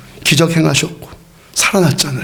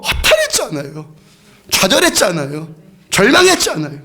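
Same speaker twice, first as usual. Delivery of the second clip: fervent preaching; 8 semitones higher than usual. Acted. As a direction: preach it out with rising fire. Preaching at full force.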